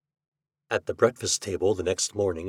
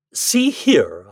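louder, firm reproof; stern; 3 semitones higher, 7 dB louder than usual. Acted. Husband confronts the apparition, stern. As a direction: pull the ghost up sternly. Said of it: "I said sternly".

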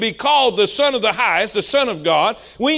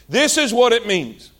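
ordinary delivery

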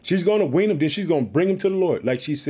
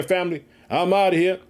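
ordinary talk

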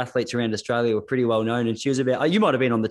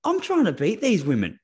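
lightly voiced, much higher scheming woman; mock cheeky voice; 9 semitones higher than usual. Host imitates the athlete's sly scheming in a high voice. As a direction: pitch it up like a sly rival plotting. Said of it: "She goes".

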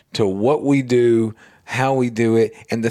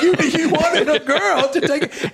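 high, laughing through the imitation; pitched-up mock stand-up voice with laughter; retold comedian. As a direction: retell the comic's line while cracking up. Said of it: "he's a comedian".

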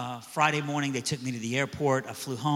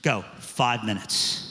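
slow, drawn-out go; grudging permission; 5 semitones lower, much slower than usual. Retold or imitated. Mean kid grudgingly granting five minutes, slow. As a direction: make him wait, reluctant. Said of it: "he goes".

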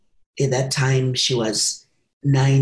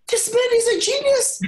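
much higher, laughing through the words; laughing delight. Real, not acted. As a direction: laugh as the trick dawns on you. Delighted realisation about the uncle.

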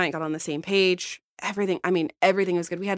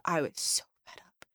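fed-up whisper, much softer; 3 semitones lower, 12 dB quieter than usual. Real, not acted. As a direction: breathe it out under your breath. Reliving frustrating client call.

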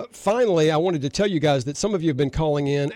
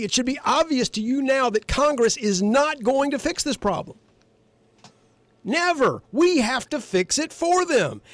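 higher, heated and emphatic; worked up; 7 semitones higher than usual. Real, not acted. Host lecturing listeners, worked up and emphatic. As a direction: drive the point home, fired up. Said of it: "I'm getting a little worked up here".

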